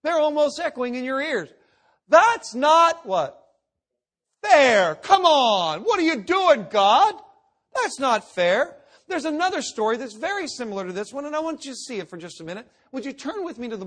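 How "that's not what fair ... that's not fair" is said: loud, indignant complaint to God; whining protest. Retold or imitated. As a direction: cry out that it's unfair, aggrieved. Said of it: "You can almost hear the words of Cain".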